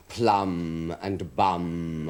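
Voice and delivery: "Plum" and "bum" very slowly, vowels savoured; round, rich vowels. Shown as a demonstration. Quoting Mervyn Peake on lovely sounds, slow relish on "Plum" and "bum". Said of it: "They're so round and rich".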